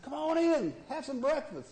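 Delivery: put-on high old man voice. Preacher voicing the old farmer welcoming the hunter in.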